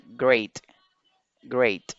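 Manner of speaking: great with the A said as the English letter name "ay"; silent E in great. Shown as a demonstration. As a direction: say "great" with a long "ay", no final E sound. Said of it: "solo se pronuncia la A". (great con A inglesa, "ei", sin E final)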